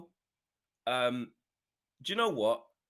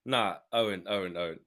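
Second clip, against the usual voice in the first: ordinary talk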